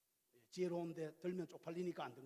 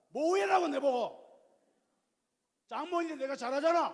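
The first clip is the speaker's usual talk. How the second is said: irritated husband; 8 semitones higher, 12 dB louder than usual. Retold or imitated. Husband rebuffs wife over mother-in-law, loud and indignant. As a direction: snap back like a defensive husband. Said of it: "막 이래".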